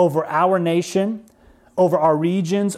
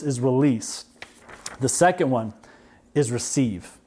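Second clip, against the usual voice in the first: normal talking voice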